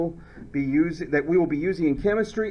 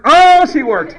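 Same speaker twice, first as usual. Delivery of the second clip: much louder and higher burst; teacher surprised and delighted as a student works; delighted surprise; 11 semitones higher, 15 dB louder than usual. Real, not acted. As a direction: exclaim it, caught off guard. Student just did the task.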